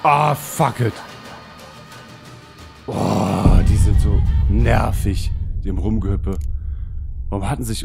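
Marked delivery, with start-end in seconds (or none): 0.0-6.4 loud annoyed outburst; frustrated. Real, not acted. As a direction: vent loudly at the pests. Annoyed by jumping monsters.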